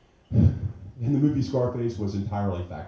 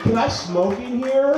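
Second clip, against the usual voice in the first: exasperated; 13 semitones higher, 6 dB louder, slower than usual. Retold or imitated. Impatient customer at fussy bar.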